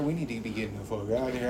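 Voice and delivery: low, quiet, hurried imitation; imitating ominous warning. Quoting the rich man in the video, low imitated urgency. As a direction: mimic him warning under his breath. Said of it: "I'm listening to this motherfucker".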